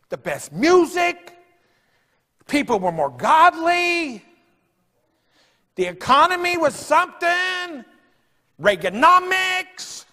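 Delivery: high, slow, drawn-out praise; high nostalgic preaching. Real, not acted. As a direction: savour each item slowly.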